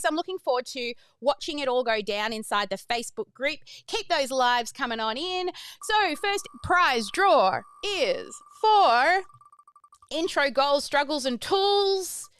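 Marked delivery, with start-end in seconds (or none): 5.8-12.3 drawn-out announcer. Acted. Prize draw announcement.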